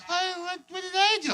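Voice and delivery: put-on puzzled innocent small-girl question; little girl. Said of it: "and she's like".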